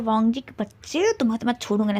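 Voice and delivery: ordinary delivery, nothing marked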